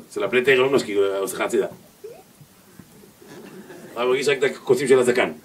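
normal talking voice

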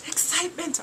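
high, excited burst; mock fangirl excitement; excited squeal. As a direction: squeal it like a fan who just got news. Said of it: "I'm like".

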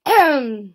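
loud, deliberate "ahem"; throat clearing. A narrator's reading. Bear readying himself to recite to Mouse, loud throat clearing. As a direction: clear the throat pointedly and loudly. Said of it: "Bear cleared his throat".

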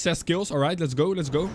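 ordinary delivery

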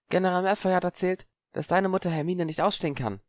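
put-on high girl's voice, narrowed and probing; wary girl countering; suspicious girl Parvati. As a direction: challenge him lightly.

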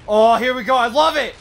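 loud, high hype; excited. Real, not acted. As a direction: cheer it on loudly. Hype at a kill streak.

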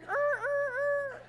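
mock stalled record noise; record grinding to a halt. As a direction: drag the sound down like a record being stopped. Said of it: "The record went".

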